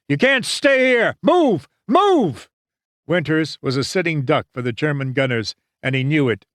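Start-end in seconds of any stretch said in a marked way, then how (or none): 0.1-2.5 high, frantic yelled command; officer blowing his cork, pitch way up. A narrator's reading. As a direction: yell at men frozen under fire. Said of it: "he yelled".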